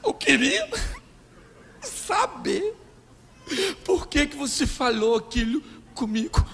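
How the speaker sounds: high, broken sobs between words; hammed-up tearful questioning; mock crying wife. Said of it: "ela começa a chorar".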